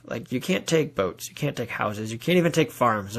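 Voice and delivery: usual speaking voice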